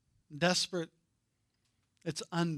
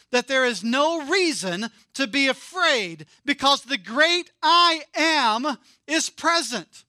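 climbing from talk to a loud preaching peak; building sermon; 6 semitones higher, 8 dB louder than usual. Acted. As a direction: preach it bigger line by line until you thunder. Preaching builds to full force.

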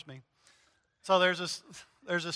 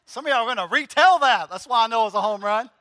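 proud laughing boast; 2 semitones higher, 6 dB louder, faster than usual. Real, not acted. Boasting after his joke.

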